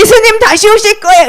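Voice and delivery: high, ringing proclamation; rousing street-preacher call; evangelist's cry. Acted. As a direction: announce it high to a whole crowd. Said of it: "이렇게 말했어".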